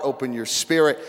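normal talking voice

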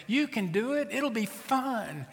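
high, upbeat coaxing mother; coaxing mom. Acted. Mother talks scared girl onto a ride, coaxing. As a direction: talk a nervous child into it, pitched high. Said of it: "trying to talk a seven-year-old girl into getting on".

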